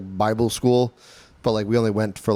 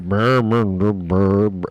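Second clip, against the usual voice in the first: mumbling singer; 4 semitones lower, 4 dB louder, much slower than usual. Retold or imitated. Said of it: "He's like".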